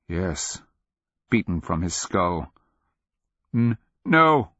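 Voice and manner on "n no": stammered no, higher and louder; sputtered protest. A narrator's reading. Captive wizard refusing what he sees, sputtered. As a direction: sputter out a startled, stuttering no. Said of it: "Akamian sputtered".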